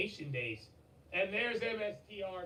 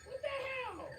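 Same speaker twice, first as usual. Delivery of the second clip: much higher, stunned yelp of disbelief; shocked shriek; slower than usual. Real, not acted. Streamer to donor Tony, shrieking in disbelief. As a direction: scream the name in disbelief.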